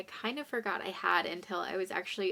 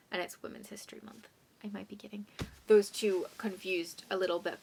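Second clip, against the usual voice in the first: whisper. Real, not acted.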